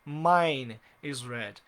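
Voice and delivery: mine is red said slowly as the right form; stretched, careful right version; slow correct example. Shown as a demonstration. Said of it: "Então uso mine".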